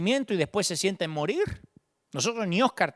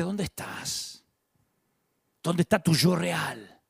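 tender call; slower than usual. Acted. Dios invitando con gracia.

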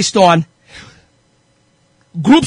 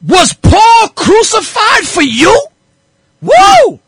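high, stretched question ending in a whoop; impassioned preaching; 11 semitones higher, 3 dB louder, much slower than usual. Real, not acted. Preacher asking if Paul was crucified, fired up.

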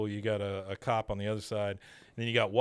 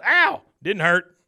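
pained yelp; 8 semitones higher, 11 dB louder, slower than usual. Retold or imitated. Dale punched in the arm.

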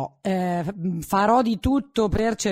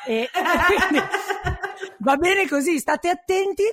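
laughing; 8 semitones higher than usual. Real, not acted. Laughs while advising listeners.

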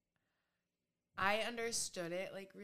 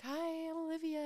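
much higher, eager hello; high bright greeting; 11 semitones higher, 5 dB louder than usual. Retold or imitated. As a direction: chirp it up high. Retold greeting to grandfather.

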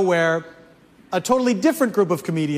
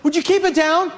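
annoyed complaint; 8 semitones higher than usual. Retold or imitated. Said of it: "they would come over and say".

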